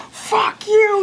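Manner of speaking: imitating a madman's high shrieked curse; high-pitched screamed insult; crazed shout. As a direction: shriek it like a lunatic. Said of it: "he turns around and goes".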